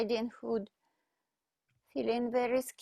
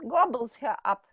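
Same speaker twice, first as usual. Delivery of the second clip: ordinary delivery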